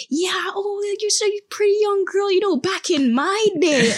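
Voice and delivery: high-pitched mock little old lady; chatty elderly Canadian. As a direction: gush on and ramble in a high elderly voice. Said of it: "elderly Canadian people, they're so talkative".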